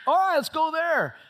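loud, high pretend enthusiasm; mock snap decision. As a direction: make a breezy random choice, loudly. Mock picking a place by dart.

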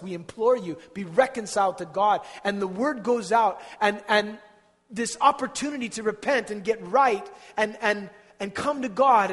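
loud, high, full-force sermon climax; impassioned preaching. Acted. Preacher pleading with the people, full-force preaching. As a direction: pour it out over the congregation.

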